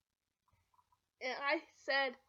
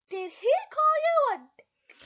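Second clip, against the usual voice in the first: put-on kid's loud question; 10 semitones higher, 11 dB louder than usual.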